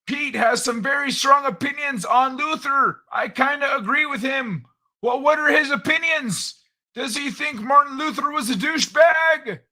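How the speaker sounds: put-on high, loud old-man character voice; Old Man Kai voice. Host ribbing his co-host, as a high, loud old man.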